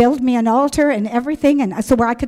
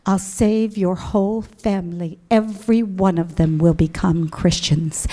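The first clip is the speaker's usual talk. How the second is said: calm voice of God; 3 semitones lower than usual. Retold or imitated. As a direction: answer the yelling with calm divine assurance. God answers her yelling, calmly.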